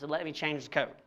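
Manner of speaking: usual speaking voice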